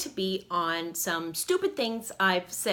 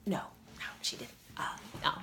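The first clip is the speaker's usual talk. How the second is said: whispered aside; 3 semitones lower, 10 dB quieter, faster than usual. Real, not acted.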